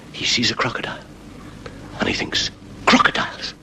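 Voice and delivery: hushed suspense whisper. Acted. Storyteller hushing the audience as the crocodile appears. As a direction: drop to a secretive whisper.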